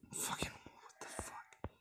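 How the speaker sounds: hushed panicked whisper. Real, not acted. Player hiding from the chaser, hushed whisper. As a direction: breathe it out in hiding.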